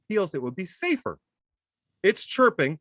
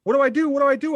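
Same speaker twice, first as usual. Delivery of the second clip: high, louder frantic pleading; panicked wife; 11 semitones higher, 7 dB louder, much faster than usual. Retold or imitated. Retelling his wife's panic, a high frantic plea. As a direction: mimic his wife fretting over the bird, higher. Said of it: "And she's".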